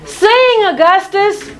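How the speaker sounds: high urgent call; urgent cry. Acted. Lady Nell tells the prince to sing, high cry. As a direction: call out the plan. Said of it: "she cries".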